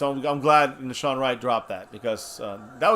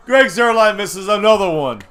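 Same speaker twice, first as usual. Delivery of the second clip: exasperated mock; 7 semitones higher, 9 dB louder than usual. Real, not acted. Mocking the missed kick.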